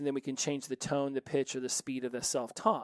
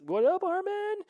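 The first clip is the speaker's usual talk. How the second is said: high-pitched hyped holler; shout-out; 3 dB louder than usual. Shown as a demonstration. Podcaster greeting a favourite trance DJ, high-pitched shout-out. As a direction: call out a high, playful greeting. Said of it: "give a shout out to trance".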